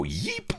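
short, high startled squeak; startled yelp. A narrator's reading. Captain jumping at her touch, startled yelp. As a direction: yelp as if suddenly tickled. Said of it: "causing Avery to jump and go".

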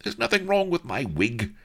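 very high, defensive outburst; indignant cry. A narrator's reading. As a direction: cry out in affronted protest. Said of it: "cried Bilbo".

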